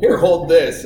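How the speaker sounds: loud, high mock dad command; retold brusque order. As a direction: thrust a heavy job on the kid. Dad handing kid tools, re-enacted.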